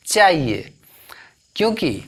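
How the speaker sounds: normal talking voice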